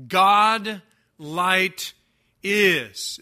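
"God" hit hard, "light" held, "is" set apart after a pause; Greek word order emphasis. Shown as a demonstration. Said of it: "the word that they wanted to emphasize first".

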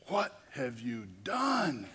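slow, drawn-out amazed question with a laugh; shocked question. Retold or imitated. Chaplain walking in on the conversion, slow amazed disbelief. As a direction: stretch it out in disbelief. Her eyes are, like, this big.

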